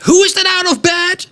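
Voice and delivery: very high, harsh, scolding matron; a strict matron catching a child out of bed; terrible lady's voice. Retold or imitated. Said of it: "a terrible lady's voice resonates through the hallway".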